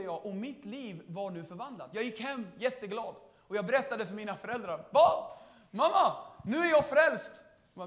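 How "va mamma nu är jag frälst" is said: louder and higher, bursting with news; excited announcement. Retold or imitated. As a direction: tell mom the big news. Telling parents excitedly. (upprymt till mamma)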